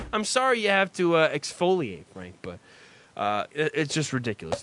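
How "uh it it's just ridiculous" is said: ordinary talk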